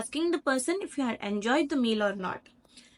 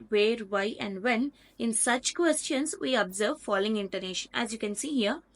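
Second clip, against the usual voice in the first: ordinary delivery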